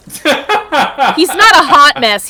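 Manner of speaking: laughing through the words; giggling as you say it. Real, not acted. Laughing while speaking.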